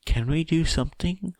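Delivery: high, timid little question. A narrator's reading. Koda timidly asking classmates for help, high timid voice. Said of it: "asks Koda timidly".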